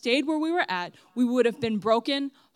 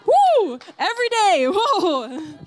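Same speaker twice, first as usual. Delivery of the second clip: excited; 9 semitones higher, 8 dB louder, slower than usual. Real, not acted. Hyping up Bible reading.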